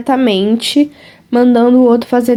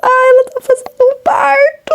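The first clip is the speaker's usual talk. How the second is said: high, tearful excited squeal; 13 semitones higher than usual. Real, not acted. Squealing over cute photo.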